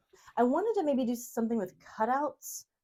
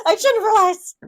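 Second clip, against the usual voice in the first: mock exasperated shout; 13 semitones higher, 13 dB louder than usual. Echoing a joke with mock despair.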